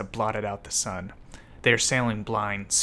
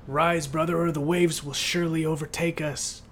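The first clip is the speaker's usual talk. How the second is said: higher, urgently pleading call; raised, pressing narrated plea; urgent cry; 6 semitones higher than usual. A narrator's reading. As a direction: cry out a warning to a brother. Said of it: "he cries".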